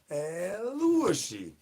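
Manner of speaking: very slow and high, each syllable stretched long; drawn-out El Wushy call. Pretend. Streamer performing the El Wushy call chat asked for, slow and high. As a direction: croon the name out slowly like a summons.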